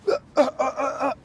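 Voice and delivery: high strained gasps fading into a cry; imitated choking sobs. Retelling a cellmate overcome by gas, high whimpering gasps. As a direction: choke and whimper as the gas hits.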